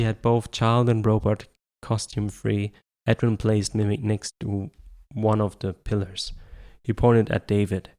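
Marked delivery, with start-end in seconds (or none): none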